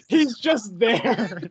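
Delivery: slow words breaking into laughter; real laughing disbelief. Real, not acted. Reviewer telling co-host of Swamp Thing's arrival, laughing. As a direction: laugh through it, drawn out.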